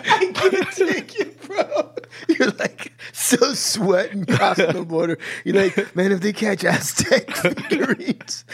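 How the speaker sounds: high-pitched, helpless laughing talk; laughing hard. Real, not acted. Friend teasing storyteller about mailed figurines, cracking up. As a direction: tease through real laughter.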